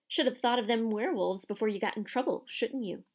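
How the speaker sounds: ordinary delivery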